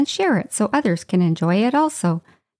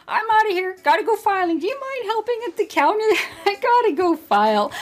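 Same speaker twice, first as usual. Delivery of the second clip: high-pitched mock-panicked getaway; acted flustered high voice, breaking into a laugh; panicked excuse; 10 semitones higher, faster than usual. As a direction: squeak out hurried excuses to escape. Acts fleeing from the returning lady.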